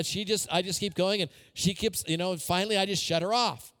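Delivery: usual speaking voice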